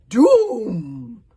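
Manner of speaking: very loud shrieked doom; witch scream. A narrator's reading. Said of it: "screamed the witch".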